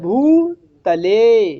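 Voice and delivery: भूतले said very slowly with a dental त, not थ; dental ta pronunciation. Shown as a demonstration. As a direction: draw out भूतले, tongue touching the teeth. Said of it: "दंती से दांत को जीभ में लगा के बोलो". (भूतले में त दंत्य, धीरे-धीरे)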